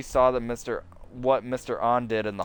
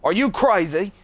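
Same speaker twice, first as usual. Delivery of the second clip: loud, higher outraged cry; shocked shout; 8 semitones higher, 7 dB louder than usual. Acted. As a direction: blurt it out in outrage. Outburst at Miss Park.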